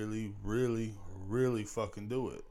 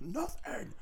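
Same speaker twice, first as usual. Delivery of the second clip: very high, strained, softer answer; retold high screech; 14 semitones higher, 6 dB quieter than usual. Screaming man answering passing drivers, high strained squeal. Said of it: "screaming at the top of my lungs".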